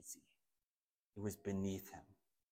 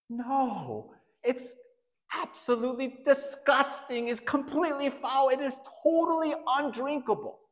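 much higher, louder, appalled; disgusted refusal; 12 semitones higher, 4 dB louder than usual. Pretend. The imagined listener rejecting the cup, appalled and much higher. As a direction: recoil at the very idea, pitch soaring.

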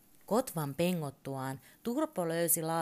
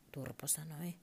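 usual speaking voice

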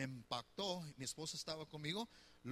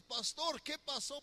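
higher, eager question to the pastor; excited asking what happened; curious church member; 8 semitones higher, 6 dB louder, slower than usual. Retold or imitated. Y tú vienes conmigo y dices.

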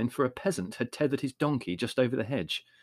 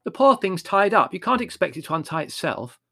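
Margot's voice; 7 semitones higher, 5 dB louder than usual. A narrator's reading. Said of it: "said Margot".

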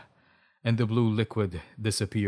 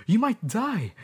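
high, louder worried warning; alarmed; 12 semitones higher, 5 dB louder than usual. A narrator's reading. Alexa warning Bob of death, alarmed. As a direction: warn him in melodramatic alarm.

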